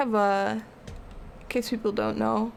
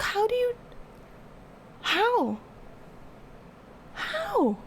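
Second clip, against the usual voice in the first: high baffled pleading; rising in disbelief; incredulous; 13 semitones higher, 4 dB quieter, much slower than usual. Retold or imitated. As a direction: squeak out the disbelief. Baffled at partner sleeping instantly.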